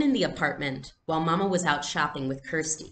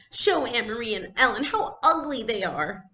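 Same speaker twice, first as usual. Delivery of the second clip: higher and louder sulky demand; bossy child; 7 semitones higher, 3 dB louder than usual. Acted. Little girl to her mother, demanding and sulky. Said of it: "she commanded".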